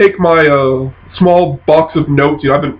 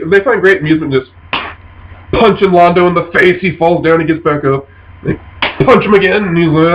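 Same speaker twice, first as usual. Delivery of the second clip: ordinary talk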